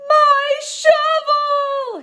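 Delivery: high, slow, sing-song wail; yelled. Acted. Little Peter wails at the fence, high drawn-out yell. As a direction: stretch it out like a toddler screaming. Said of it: "he yelled".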